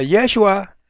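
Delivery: high-pitched call, Yeshua stressed on YE; mother calling. Retold or imitated. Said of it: "la mamá cuando lo llamaba".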